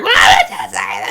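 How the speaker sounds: loud, laughing wail; mock wail. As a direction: cry it laughing. Imitating drunk crying.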